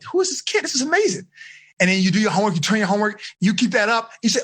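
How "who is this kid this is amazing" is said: high, astonished mom voice; amazed mom. Retold or imitated. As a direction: mom can't believe her ears. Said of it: "Your mom would be like".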